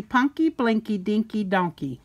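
ordinary delivery

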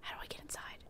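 barely voiced, under the breath; whispered musing. Real, not acted. Player puzzling over the photo booth, whispered aside. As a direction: whisper the question to yourself.

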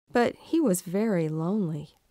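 ordinary delivery

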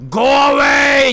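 stretched, very loud yell; long shout. Acted. Player yelling at the monster through the mic, long shout. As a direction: bellow it slowly to scare the thing off. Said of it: "シンプルにこうね".

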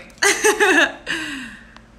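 high laugh at her own stumble; embarrassed laugh. Real, not acted. Laughing at herself for tripping over words. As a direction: laugh off the tongue slip.

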